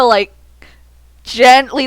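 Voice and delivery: usual speaking voice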